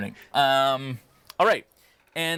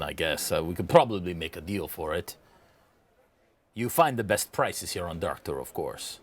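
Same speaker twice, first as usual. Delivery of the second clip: subdued shopkeeper, lower pitch; lightly voiced, low-key trader; merchant offering a deal; 6 semitones lower than usual. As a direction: offer the cheaper option half-heartedly. Game master voices merchant.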